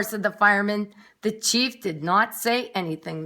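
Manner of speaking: usual speaking voice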